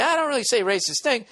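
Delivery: high-pitched, put-on mocking read; high, hammed-up echo of the letter; mock disclaimer. Shown as a demonstration. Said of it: "Or he's saying, like".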